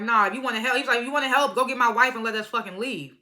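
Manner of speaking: husband demanding his wife back, voiced heatedly; angry demand. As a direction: snap back, fed up. Pissed-off husband confronting River.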